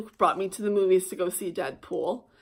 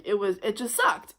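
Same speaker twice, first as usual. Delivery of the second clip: usual speaking voice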